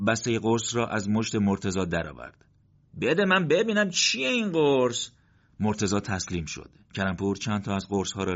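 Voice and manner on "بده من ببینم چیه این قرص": raised, slow demanding voice; curious comrade. Acted. Karampour demands to see the pills, raised and slow. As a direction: take the pills and ask, slowly.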